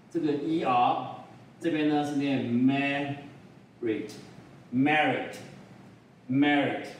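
ordinary talk

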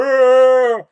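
big loud snarl; loud creature growl; monster growl. Retold or imitated. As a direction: roar it out like the cyclops. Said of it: "they're like".

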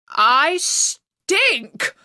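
the king's muttering bursts out loud. A narrator's reading. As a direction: blurt the last one out loud. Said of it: "the king was muttering to himself".

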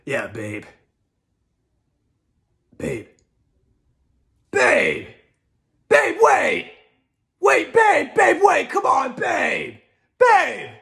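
escalating desperate yells after babe; Will Arnett chasing babe in Hot Rod; committed Will Arnett. Retold or imitated. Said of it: "really committed version of an impression".

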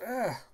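short high acted cry; high-pitched "ah" of pain; imitated pain yelp. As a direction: yelp as if struck. Character struck by thorny branch.

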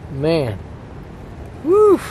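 usual speaking voice

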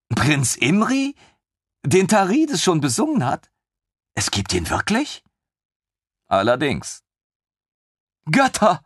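high, breathless delight; lieutenant overjoyed; excited lieutenant. Acted. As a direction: gush with amazement.

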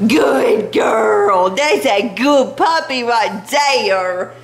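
gushing pet voice, slow; cooing dog praise. Real, not acted. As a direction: praise the puppy, stretching every word.

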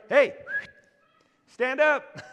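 loud, high call of a bemused lifeguard; amused lifeguard. Retold or imitated. Lifeguard calling out to the panicking swimmer, high and loud. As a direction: tell her the obvious, as if shouting across the water. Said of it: "can you imagine the lifeguard".

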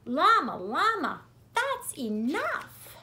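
a mother stopping a tantrum; Mama Llama stops her son's tantrum, stern and high; mama scolding. Acted.

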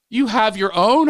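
high, loud mock disbelief; hammed-up astonished question; mock shocked question. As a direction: act out an aghast mother. Acts shocked mother asking back.

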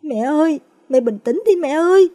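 high, urgent plea; daughter-in-law pleads with the grieving mother, high and scared; frightened pleading. Acted. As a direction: beg the mother-in-law to calm down. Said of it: "Cô Thiêm sợ hãi".

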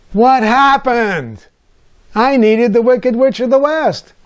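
put-on high, slow childish whine; little boy crushed that the witch is a plain woman; disappointed child.

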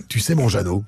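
ordinary talk, nothing marked

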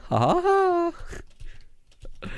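very high, slow fake laugh; mocking high laugh. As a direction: cackle it out, mocking.

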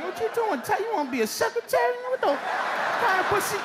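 high mocking teen jeer; teen bully taunt. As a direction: sneer at the typing kid. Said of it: "me and six other dudes, like".

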